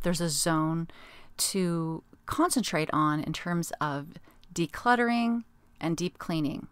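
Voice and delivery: usual speaking voice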